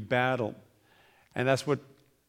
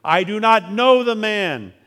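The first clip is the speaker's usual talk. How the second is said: higher, louder and slow, each word hammered; cursing denial; 7 semitones higher, 10 dB louder, slower than usual. A narrator's reading. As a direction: swear it out in fury. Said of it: "began to curse and swear".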